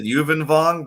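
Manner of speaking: "Yuvin Vaughn" said as YOO-vin VAWN; alternative pronunciation. Shown as a demonstration. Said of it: "I always pronounce it".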